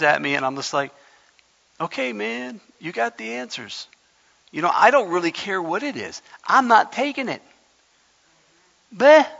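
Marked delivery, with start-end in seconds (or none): 1.8-7.4 dismissive brush-off. Retold or imitated. Waving off pushy people. 8.9-9.3 high, loud blown-out bleh of disgust; mocking bleh. Preacher scoffs at the fanatics with a loud, high bleh.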